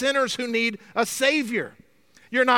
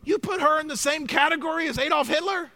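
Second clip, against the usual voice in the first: incredulous self-righteous; 5 semitones higher than usual. Retold or imitated. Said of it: "the self-righteous are going".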